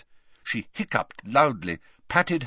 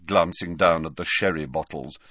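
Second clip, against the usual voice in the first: ordinary delivery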